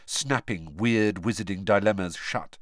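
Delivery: ordinary delivery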